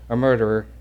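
ordinary talk, nothing marked

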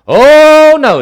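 very high, loud drawn-out cry; hammed-up protest. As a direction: wail a mock refusal at full volume. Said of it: "he pipes up again and he basically says this".

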